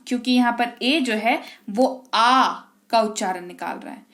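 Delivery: ordinary talk, nothing marked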